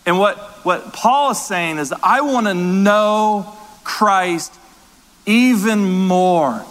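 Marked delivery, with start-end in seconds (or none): 2.0-6.7 drawn out, each word weighted; slow earnest quote. Retold or imitated. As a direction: savor the longing in the words. Quoting Paul with fervor.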